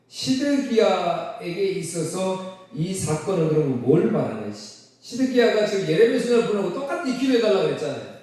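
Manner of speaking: usual speaking voice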